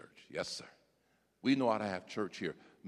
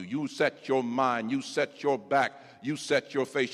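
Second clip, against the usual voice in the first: ordinary talk, nothing marked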